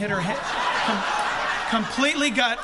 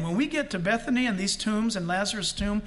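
normal talking voice

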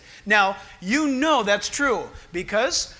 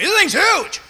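higher, louder astonishment; amazed wife; 8 semitones higher, 6 dB louder than usual. Retold or imitated. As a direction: gape at the huge burrito. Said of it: "Rebecca goes".

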